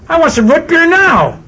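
high, loud bratty demand; spoiled boy yells at his parents, high and loud; demanding child. Retold or imitated. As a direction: stamp it out like a brat.

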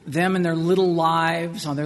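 ordinary delivery

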